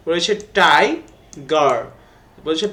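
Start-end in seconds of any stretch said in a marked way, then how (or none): 0.6-1.8 "tiger" in two separate beats, tai, gar; split into syllables. Shown as a demonstration. Teacher dividing "tiger" for pupils, split into two syllables. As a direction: say "tiger" slowly, pausing between its syllables. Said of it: "আমরা ভাগ করি".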